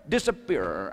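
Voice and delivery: ordinary delivery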